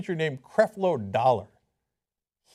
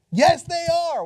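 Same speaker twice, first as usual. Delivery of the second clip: higher, louder insistence; emphatic retort; 9 semitones higher, 6 dB louder, slower than usual. Real, not acted. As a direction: snap back with certainty.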